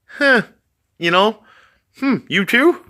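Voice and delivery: high, amused mock boss; casual boss voice. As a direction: shrug it off like a knowing boss. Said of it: "the boss caught you and he was like".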